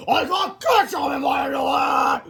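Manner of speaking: high, slow, drawn-out holler; screaming. A narrator's reading. Principal splattered in the restroom, screaming. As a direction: howl it loudly in outrage. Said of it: "screamed Mister Krupp from inside the restroom".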